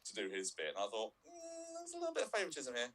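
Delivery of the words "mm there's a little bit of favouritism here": high-pitched doubting murmur; mock suspicion pitched way up; sceptical aside. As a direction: think aloud in a high, suspicious little voice. Voices own sceptical thought.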